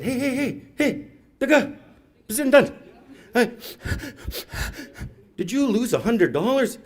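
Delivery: high, sweet coaxing voice; lightly hammed-up baby talk; coaxing kid. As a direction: coax a crying child gently. Calling to crying kid.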